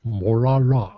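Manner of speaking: Molala with a Scottish Connery burr; Sean Connery accent. Pretend. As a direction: say it like a Scottish film spy. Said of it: "with the Sean Connery accent".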